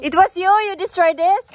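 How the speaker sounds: hammed-up shock, pitch up; mock accusing. Mother to her son who admits the damage, playful accusation. Child confesses wrecking plants.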